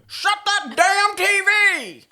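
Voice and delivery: put-on high, loud, slow cranky old man yell; grumpy old man. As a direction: bellow like a grouchy old man who can't hear. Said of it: "I'm gonna be an old man".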